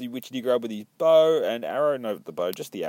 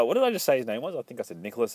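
ordinary talk

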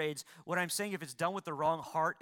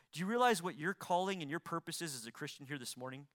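ordinary talk

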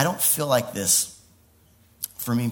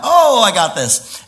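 loud, higher, drawn-out "oh"; acted elation; 7 semitones higher, 9 dB louder, slower than usual. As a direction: burst out with sudden confidence. Acts out confidence after God speaks.

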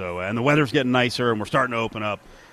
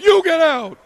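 much higher and louder mock shout; angry yell back; 9 dB louder than usual. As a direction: fire it back like a furious heckler. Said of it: "then he's like".

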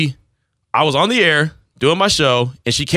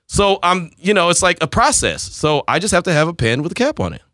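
ordinary talk, nothing marked

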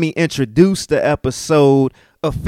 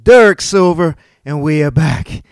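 loud, high radio-style show opener; hype host intro; 7 semitones higher, 8 dB louder than usual. Acted. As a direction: announce the show like a DJ. Podcast intro, host announcing.

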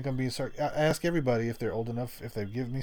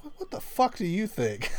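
higher, indignant snap of a lady offended; offended old lady; 10 semitones higher than usual. Retold or imitated. Old lady insulted at being carded, higher indignant snap. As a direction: bite back, insulted by the question.

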